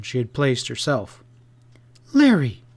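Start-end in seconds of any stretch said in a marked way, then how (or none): 2.1-2.6 high, loud, sharp exclamation; startled, reproving cry; sharp rebuke. A narrator's reading. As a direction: cut him off sharply with his name. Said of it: "she said sharply".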